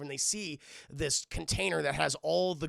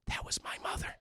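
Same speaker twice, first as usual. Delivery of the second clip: hushed unvoiced whisper; 7 dB quieter than usual. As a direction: whisper it like a secret. Mock shocked aside.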